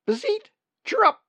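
high, loud bird chirp; bird call. A narrator's reading. Narrator voices the dipper's calls.

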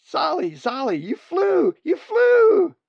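very high, loud squeals of joy; excited cheering. Acted. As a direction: nestlings shrieking with delight. Siblings cheering first flight.

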